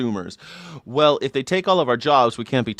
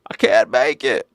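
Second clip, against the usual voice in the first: high, strained old-timer impression; put-on old person, stammering and pitched up; struggling old worker; 11 semitones higher than usual. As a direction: plead like a hammed-up elderly worker. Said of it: "The one that are like".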